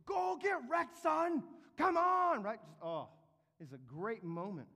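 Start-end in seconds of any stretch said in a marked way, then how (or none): 0.1-2.4 very high, loud jeering cheer; taunting shout. Retold or imitated. Angels jeering down at Haman, very high loud taunt. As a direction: razz him like a rowdy fan. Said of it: "but they're going".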